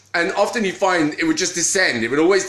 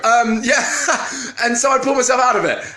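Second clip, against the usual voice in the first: laughing; 8 semitones higher than usual. Real, not acted. Laughing after squirrel distraction.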